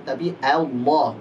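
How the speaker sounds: normal talking voice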